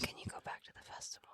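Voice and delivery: very soft, hurried whisper; real whisper. Real, not acted. Co-host steering host back to festival, hurried whisper. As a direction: whisper a quick request to move on. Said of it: "Why are you whispering?".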